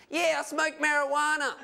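high-pitched interviewee voice; young marijuana smoker. Acted. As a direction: play the interviewee, pitched well above your own. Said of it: "This young man smoked marijuana".

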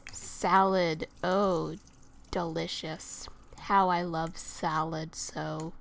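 low, flat-lined mock delight over salad; sarcastic. Mocking the salad, low sarcastic praise. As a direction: fake enthusiasm, dripping with sarcasm. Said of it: "really super sarcastic".

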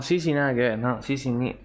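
ordinary talk, nothing marked

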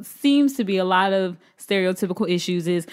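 normal talking voice

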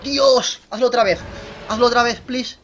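loud, high-pitched pleading; excited begging. Real, not acted. Player begging a dinosaur to do it again, thrilled.

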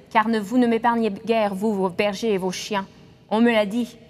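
ordinary delivery

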